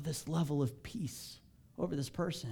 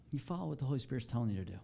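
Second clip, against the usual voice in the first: ordinary delivery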